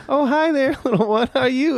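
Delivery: put-on high sing-song voice for a little kid; cooing at a child. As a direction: coo it like greeting a toddler. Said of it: "She's like".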